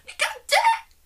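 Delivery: extremely high, loud squeal; mock screaming. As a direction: imitate an overexcited contestant's shriek. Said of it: "That's what it sounds like".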